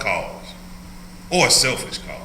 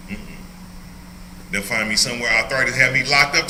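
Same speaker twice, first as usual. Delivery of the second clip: normal talking voice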